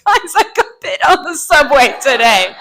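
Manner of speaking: high, hammed-up distressed voice; loud, high mock outrage; acted victim's complaint. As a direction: play the bitten woman telling coworkers. Imagines victim telling her coworkers.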